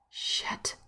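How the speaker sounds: hissed under the breath; a shocked whispered swear; whispered curse. Acted. Discovering husband is stoned.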